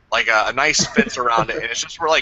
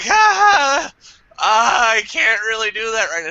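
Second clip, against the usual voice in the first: nervous laugh off; 9 semitones higher, 4 dB louder than usual. Retold or imitated. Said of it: "I was like".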